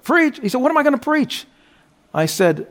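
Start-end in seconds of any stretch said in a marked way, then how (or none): 0.0-1.5 a stunned pastor who can't believe it; startled disbelief. Retold or imitated. Frightened pastor asked to preach, high and incredulous. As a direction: pitch jumps high, incredulous.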